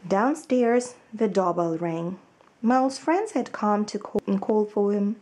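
put-on lively storybook reading for children; story narration. A narrator's reading. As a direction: read it like a picture book to little ones. Teacher reads children's story aloud.

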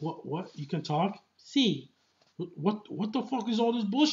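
incredulous, rising mock anger; mock-outraged Logan impression; imitating Logan's outburst. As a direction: snap out the disbelief in a put-on voice. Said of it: "where he's like".

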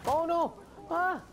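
sudden high frightened shriek; startled scream. Real, not acted. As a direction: yelp in real fright. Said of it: "You scared me".

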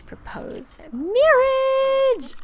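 high drawn-out squeal of delight; slow, high, excited proclamation; excited announcement. Real, not acted. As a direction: build to a gleeful high cry. Announces proposal in game.

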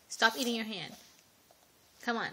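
usual speaking voice

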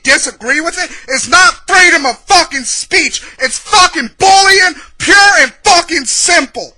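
loud, high, emphatic rant; angry shouting. Real, not acted. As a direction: pound out each accusation in rage. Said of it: "this is gonna be a fucking angry".

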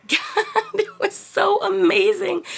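laughing. Real, not acted. Laughing while recalling the scene.

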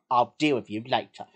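low-pitched warning father voice; stern dad. Acted. Dad warns his son mid-family outing, low and stern. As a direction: give a low, controlled telling-off.

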